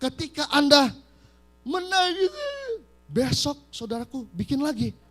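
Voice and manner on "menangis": mock crying. Acts out the crying as he says it.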